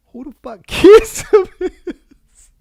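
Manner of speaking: loud, high, slow baffled yell; incredulous shout. Retold or imitated. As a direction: shout it in total disbelief. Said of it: "So I'm like".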